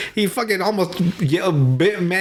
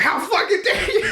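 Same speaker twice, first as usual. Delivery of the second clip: mock outrage. Retold outraged quote.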